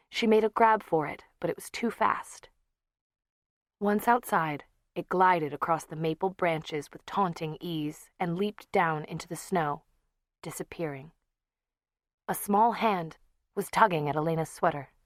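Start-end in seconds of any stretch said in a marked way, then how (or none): none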